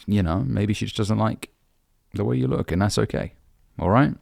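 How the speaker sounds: ordinary delivery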